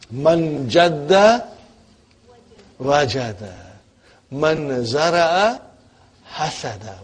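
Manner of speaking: slow, measured Arabic proverb; slow proverb recitation. Shown as a demonstration. Teacher reciting an Arabic proverb to the class, slow and measured. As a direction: recite it slowly word by word. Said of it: "reciting proverb slowly".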